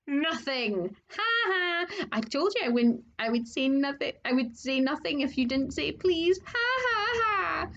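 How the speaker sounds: high cackling taunt; gloating poltergeist. Acted. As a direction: crow over the trick with glee. Peeves gloating, cackling at Filch.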